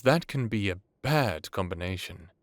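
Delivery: ordinary talk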